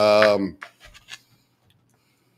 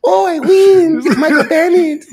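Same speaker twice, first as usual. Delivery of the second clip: mock Spanish accent; 7 dB louder than usual. [name] doing Sofia's accent for the crowd, high and hammed up. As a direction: play Sofia Vargas with a thick put-on Spanish accent. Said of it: "Can you announce Sophia as the winner in her accent?".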